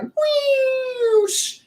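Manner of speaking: high, airy swoosh of a capsizing ship; whoosh sound effect. Retold or imitated. Vlogger mimics a capsizing ship whooshing. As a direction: make the wave noise.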